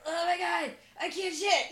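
strained, comic cry of distress; mock straining. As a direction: play straining on the toilet. Acting out straining in the stall.

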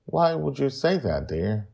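plain reading voice

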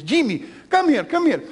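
calling Jimmy over in a lightly put-on thick accent; grandmother's thick accent. Grandmother calling young Jimmy home from school, in a thick accent. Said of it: "she's got a really thick accent".

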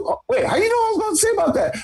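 surprised. Retold or imitated. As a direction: rush it out, startled and high. Retold surprised asker.

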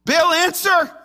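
loud, high desperate call to a god; imitated frantic prayer shout; pleading cry. Prophets crying out to Baal.

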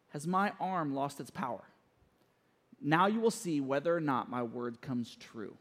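low, hushed divine reply; quietly authoritative. Acted. The Lord answering doubting Moses, low and quiet. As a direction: drop low and soft with calm authority.

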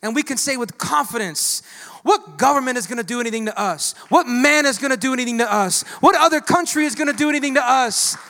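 loud, rising sermon build; fired-up pastor in full cry; preaching at full force. Acted. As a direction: preach it to the rafters.

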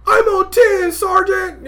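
high, loud put-on recruit voice; mocking high-pitched trainee; whiny private. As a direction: squeal it like a rattled young private. Mimics whiny private to sergeant.

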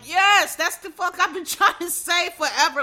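loud, high outburst of vindication; excited agreement. Retold or imitated. Retelling own reaction to the advocate's answer, excited agreement. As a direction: burst out in emphatic agreement, laughing through it.

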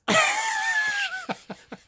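very high, squealing laugh; real high laughter; high-pitched laughing fit. Real, not acted. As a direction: crack up helplessly at the joke. Cracking up after the punchline.

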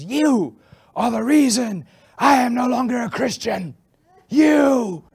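loud, high, pointed blame; screaming accusation. Retold or imitated. Woman screaming at the pastor on the street, loud angry accusation. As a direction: scream the blame right at him. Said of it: "screaming at me".

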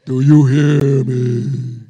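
huge slow booming voice; booming voice of God. Retold or imitated. Preacher mocking a booming God voice. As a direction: boom it out like a thundering God. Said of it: "it ain't no boom, boom".

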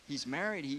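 normal talking voice

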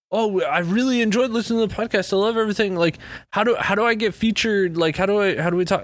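mock-keen email writer; eager fan emailer. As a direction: put on a pleading fan's high voice. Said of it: "they're just like".

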